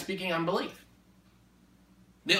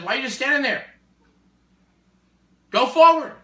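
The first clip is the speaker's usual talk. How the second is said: rising to a loud command; voicing God's command; 7 semitones higher, 8 dB louder than usual. God ordering the people forward, loud command. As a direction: urge them forward with force. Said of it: "And he says".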